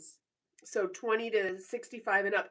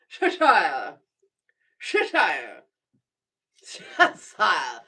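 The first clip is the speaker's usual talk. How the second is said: much louder, repeated Cheshire in a put-on British voice; mock British accent; 3 semitones lower, 9 dB louder, much slower than usual. Practising a viewer's county name, mock British. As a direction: keep trying Cheshire the British way. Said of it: "Am I saying it correctly?".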